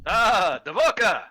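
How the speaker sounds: booming self-introduction; proud shout. Acted. The athlete hailing his own name, loud and proud. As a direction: announce the name with pride. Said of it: "greets self proudly".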